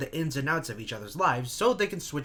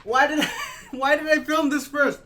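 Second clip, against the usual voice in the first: laughing through a sticky mouthful; giggling helplessly at yourself; 10 semitones higher, 7 dB louder than usual. Real, not acted. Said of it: "it's all over my mouth".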